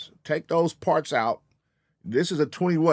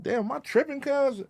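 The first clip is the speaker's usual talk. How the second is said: high, soft disbelief; 10 semitones higher, 5 dB quieter than usual. Retold or imitated. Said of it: "I'm like".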